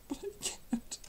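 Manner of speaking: high, breathy sob on the words; crying. Acted. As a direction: choke it out through tears. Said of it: "I don't normally cry like this".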